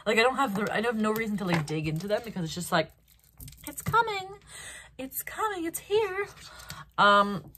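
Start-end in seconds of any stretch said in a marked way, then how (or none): 3.7-6.3 singsong announcing. Acted. Teasing announcement of arrival.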